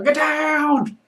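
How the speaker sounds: high, loud yell to take cover; shouted warning. Acted. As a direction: shout a warning across a crowded room. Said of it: "Are you shouting this".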